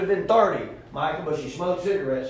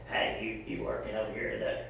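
smoker woman voice; 5 semitones lower, 10 dB quieter than usual. Retold or imitated.

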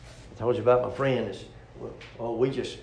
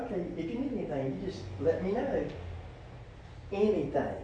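flirty woman voice; 3 semitones higher, 9 dB quieter than usual. Retold or imitated.

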